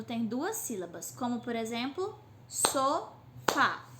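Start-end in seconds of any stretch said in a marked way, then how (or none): 2.5-3.9 "sofá" broken in two, SO, FÁ; syllable-counting demo of "sofá". Shown as a demonstration. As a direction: say "sofá" with a clear pause between so and fá. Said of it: "Cada vez que eu abro minha boca".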